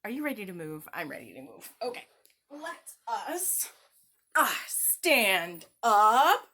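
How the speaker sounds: usual speaking voice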